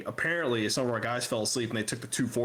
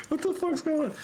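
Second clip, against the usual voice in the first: very high, squeaky incredulity; laugh-strained cry of confusion; bewildered. Retold or imitated. Acts his bewilderment, still laughing.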